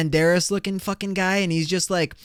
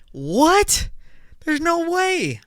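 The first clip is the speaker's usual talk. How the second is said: high-pitched disbelieving outburst; incredulous; 14 semitones higher, much slower than usual. Real, not acted. Podcaster reacting to French as most romantic language, incredulous. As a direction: shoot way up in pitch, stunned.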